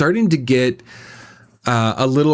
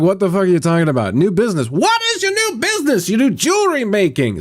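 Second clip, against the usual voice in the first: confused disbelief; 7 semitones higher, faster than usual. Retold or imitated. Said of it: "'Cause I was like".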